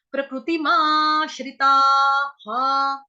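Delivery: slow teacher demo ending in ha; visarga as ha. Shown as a demonstration. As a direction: drag out प्रकृतिमाश्रिताः and end it on an audible हा. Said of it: "विसर्ग ... उच्चारण होगा हा से".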